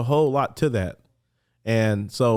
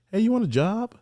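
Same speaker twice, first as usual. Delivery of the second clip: chaplain offer; 11 semitones higher than usual. Retold or imitated. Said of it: "chaplain comes like".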